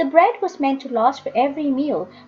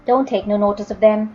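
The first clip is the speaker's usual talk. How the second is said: plain reading voice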